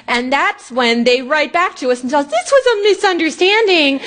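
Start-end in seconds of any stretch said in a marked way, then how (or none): 2.3-4.0 mock sheepish excuse. Mocking the agency's reply.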